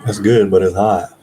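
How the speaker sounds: talking while chewing; words around a bite of pickle; mouth full. Real, not acted. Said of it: "I'm eating pickles".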